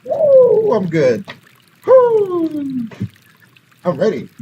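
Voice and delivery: high, loud pumped-up woo; excited whoop. Retold or imitated. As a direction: hype yourself up. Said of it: "ganahan is like".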